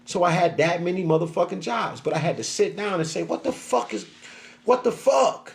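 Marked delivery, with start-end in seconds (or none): 3.2-5.5 higher, pleading "what the fuck"; frustrated. Retold or imitated. Man puzzling over losing jobs, frustrated. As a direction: vent the confusion at yourself.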